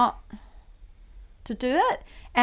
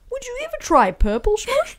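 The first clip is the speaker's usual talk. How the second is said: imitating the dad; 14 semitones higher, 3 dB louder than usual. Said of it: "he'd turn to you and go".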